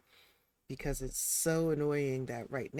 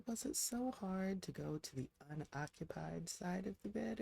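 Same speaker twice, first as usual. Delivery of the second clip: ordinary delivery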